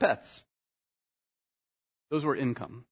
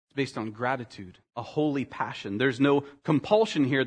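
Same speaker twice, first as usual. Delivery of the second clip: normal talking voice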